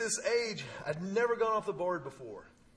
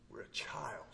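hushed whisper, softer; 4 semitones lower, 10 dB quieter than usual. Real, not acted. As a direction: breathe the words like a secret. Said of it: "sermon climax".

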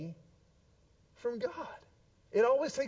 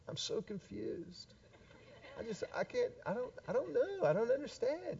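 soft, higher, helpless dithering; mock confused whine; 3 semitones higher, 11 dB quieter than usual. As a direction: play a believer pretending not to understand God. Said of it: "and go".

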